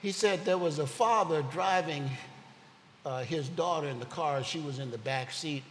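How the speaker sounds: normal talking voice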